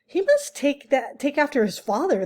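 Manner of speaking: a curious little animal teasing; skunk voice. A narrator's reading. Reader voicing the skunk to the robot, high and amused.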